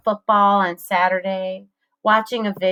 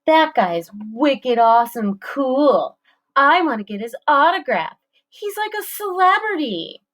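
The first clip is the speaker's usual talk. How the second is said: climbing higher and higher nerd voice; smirky nerdy voice; 6 semitones higher than usual. A narrator's reading. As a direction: gush like a sarcastic fanboy. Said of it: "in a smirky, nerdy voice".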